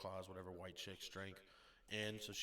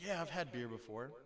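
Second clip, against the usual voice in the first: proud boast; 5 semitones higher, 5 dB louder than usual. Retold or imitated.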